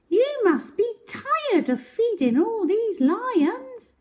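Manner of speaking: put-on very high chirpy girl; high helpful little voice; little Miss Quick voice. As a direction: squeak it up high and eager. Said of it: "she said".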